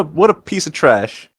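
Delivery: ordinary talk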